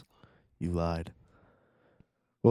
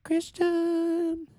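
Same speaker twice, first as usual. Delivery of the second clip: squeaky sing-song call; 4 dB louder than usual. Acted. Calling name in silly voice.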